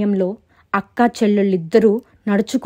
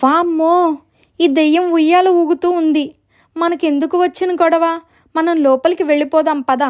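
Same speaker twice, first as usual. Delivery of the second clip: high, alarmed sister; raised, tense storybook voice; acted fright; 8 semitones higher than usual. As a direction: urge a hurried retreat in fear. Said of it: "మరింత భయపడుతూ".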